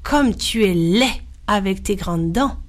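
usual speaking voice

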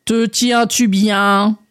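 very slow, drawn-out frog voice; concerned frog. Acted. Frog checking that André holds on, slow and drawn out. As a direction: stretch the question out like a big frog. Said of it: "s'inquiéta-t-elle".

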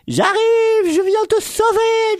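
very high, loud heroic squeal; cri de sauveteur. Acted. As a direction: shout it drawn out like a tiny hero. Chaton crie qu'il vient au secours. (cri héroïque de chaton, très aigu)

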